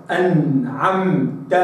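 very slow أنعمت with the noon light and exact; refined izhar. Shown as a demonstration. Teacher modelling the refined clear noon. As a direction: draw out أنعمت, noon clear but not forced. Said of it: "هكذا".

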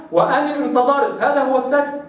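ordinary talk

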